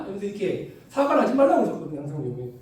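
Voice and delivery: normal talking voice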